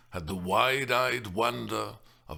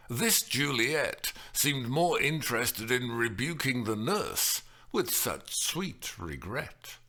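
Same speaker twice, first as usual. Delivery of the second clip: plain reading voice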